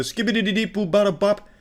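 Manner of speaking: lightly mocking scat babble. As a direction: rattle off nonsense like a flustered scat singer. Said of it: "she just did a full scat man".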